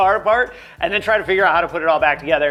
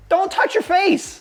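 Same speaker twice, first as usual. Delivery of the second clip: exasperated; 14 semitones higher, slower than usual. Retold or imitated. Mocking greasy videos.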